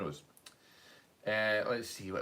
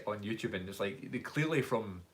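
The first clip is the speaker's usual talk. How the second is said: normal talking voice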